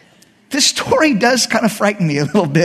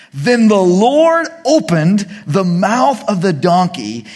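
slow dramatic reading; slower than usual. Acted. Dramatic build to the miracle.

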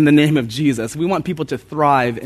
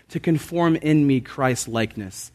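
usual speaking voice

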